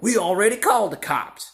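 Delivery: high-pitched, heated comeback; retold angry retort. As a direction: mimic the yelling gang shooting back. Retold retort from the yelling gang.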